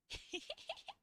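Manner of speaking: high breathy giggle; soft laugh. Real, not acted. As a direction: giggle in a whisper.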